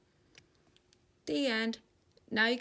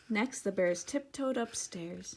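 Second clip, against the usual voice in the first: ordinary delivery, nothing marked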